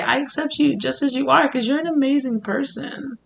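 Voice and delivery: quiet tender reassurance; tender acceptance. Retold or imitated. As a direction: gently tell them they're accepted. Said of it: "I hear somebody say".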